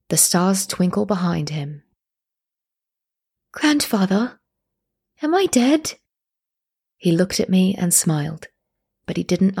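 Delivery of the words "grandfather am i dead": put-on high, small childlike voice, rising at the end; child's question. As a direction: ask it like a lost little girl. Child Ada asks her dead grandfather.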